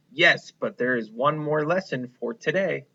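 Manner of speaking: usual speaking voice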